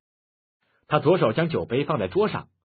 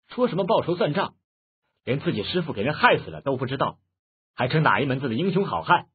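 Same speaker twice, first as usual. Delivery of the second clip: usual speaking voice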